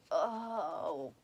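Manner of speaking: ordinary delivery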